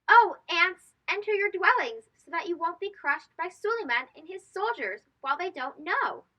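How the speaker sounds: high, loud little ant calling out in alarm; tiny ant voice. Acted. An ant warns the other ants to hide from the soldiers, high urgent call. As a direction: shout a warning like a tiny frantic ant. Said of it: "Suleiman could hear this tiny voice".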